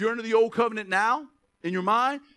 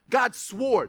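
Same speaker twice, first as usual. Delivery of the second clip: ordinary talk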